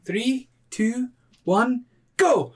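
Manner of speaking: countdown call. Acted. Counting down a race.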